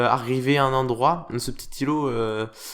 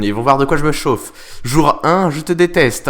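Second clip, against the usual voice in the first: mock grudge; 3 semitones higher, 7 dB louder than usual. Vows revenge on the island.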